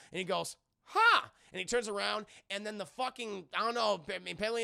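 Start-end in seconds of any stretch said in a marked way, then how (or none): 0.9-1.3 high, loud startled gasp-shout. Retold or imitated. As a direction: jump in fright.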